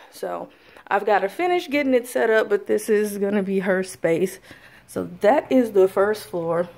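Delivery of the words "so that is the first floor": ordinary talk, nothing marked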